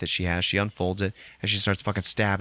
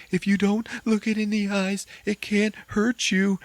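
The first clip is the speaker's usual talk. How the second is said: shaky reassurance; 13 semitones higher than usual. Retold or imitated. Lisa alone in the attic, higher trembling self-reassurance. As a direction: repeat the rule to calm herself. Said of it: "she says out loud".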